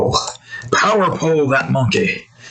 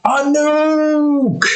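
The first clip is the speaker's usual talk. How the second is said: high, drawn-out mock-panic cry; frantic cartoon Australian wailing a warning; Australian guy; 13 semitones higher, much slower than usual. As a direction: stretch the alarm out, pitched way up. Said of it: "the guy's like".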